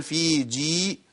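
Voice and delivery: slow "في جي" with equal long ي sounds; two-count vowels. Shown as a demonstration. Teacher showing two-count ya, slow even "في جي". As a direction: draw out "fee jee" in "في جي" evenly. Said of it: "يبقى حركتين".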